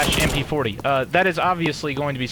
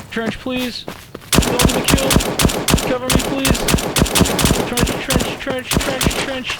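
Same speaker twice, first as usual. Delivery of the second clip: high-pitched pleading calls; cover me shout; 11 semitones higher than usual. Real, not acted. Begging for cover under fire.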